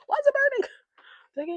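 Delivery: high, loud cry of discomfort; sudden high-pitched outburst; alarmed yelp. Real, not acted. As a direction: yelp it out as it stings. Eye stinging from makeup.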